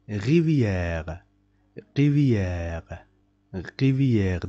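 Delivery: rivière with a long E and rolled French R. Shown as a demonstration. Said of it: "make the pronunciation of this E long".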